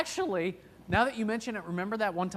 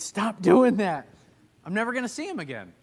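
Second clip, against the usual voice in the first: a husband mimicking his wife's pleading scold; mock wife scolding; 8 semitones higher, 11 dB louder than usual. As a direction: playfully imitate the embarrassed wife telling him off. Wife scolding him in car.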